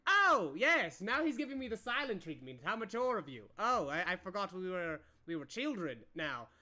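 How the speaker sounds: mock them as childish; hammed-up indignant scoffing; sarcastic outrage. Counselor mocks the silent treatment.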